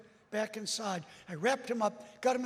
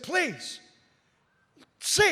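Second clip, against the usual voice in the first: pleading; 7 semitones higher, 8 dB louder than usual. Retold or imitated.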